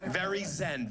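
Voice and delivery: ordinary talk, nothing marked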